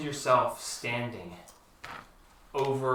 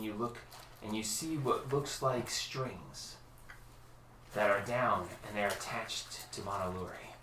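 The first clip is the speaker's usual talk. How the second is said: ominous narration; 7 dB quieter than usual. A narrator's reading. Ominous storytelling as dream sours.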